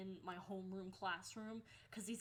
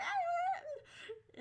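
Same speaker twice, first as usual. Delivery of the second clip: very high, loud drawn-out squeal; mock scream; 8 dB louder than usual. Student overwhelmed by the angry assistant, mock scream. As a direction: squeal in overblown panic.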